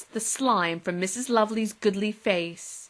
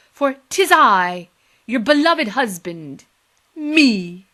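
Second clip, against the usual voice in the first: grand declaration; 2 semitones higher, 9 dB louder, slower than usual. Acted.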